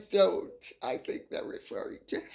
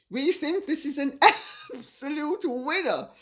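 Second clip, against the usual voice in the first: high-pitched reading with a laugh breaking in; amused reading; 5 semitones higher than usual. Real, not acted. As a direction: giggle mid-phrase and carry on. Laughing while reading fan message.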